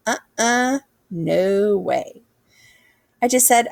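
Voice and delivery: plain reading voice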